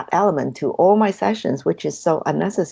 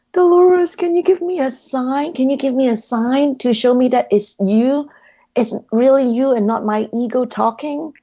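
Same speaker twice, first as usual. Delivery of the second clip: pleading; 5 semitones higher than usual. Retold or imitated. Asking Dolores for a sign, in a high pleading voice. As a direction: beg for a sign, voice lifted high. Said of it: "me being me, I still asked".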